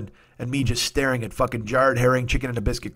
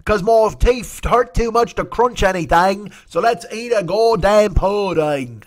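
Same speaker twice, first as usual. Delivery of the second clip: slow, loud, high old English toff with bad teeth; mock old English voice; 8 semitones higher, 5 dB louder, slower than usual. Mocking English settlers before sailing, slow English toff. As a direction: ham up an old English gent, drawn out. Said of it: "I don't know who's doing that voice".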